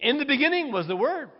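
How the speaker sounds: ringing scripture proclamation; proclaimed. Real, not acted. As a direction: announce it boldly to the congregation. Preacher proclaims opening verse.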